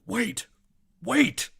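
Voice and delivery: high, loud shout of alarm; urgent cry. Acted. Voice inside the player's head breaking in, urgent high cry. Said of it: "you hear a voice go".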